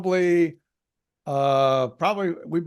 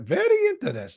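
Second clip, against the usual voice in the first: high-pitched put-on sitcom voice; Colonel Klink; 8 semitones higher than usual. Co-host recalling a sitcom line, high impression. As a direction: lift it into a comic catchphrase. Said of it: "didn't Clink or whatever from Hogan's Heroes have a line".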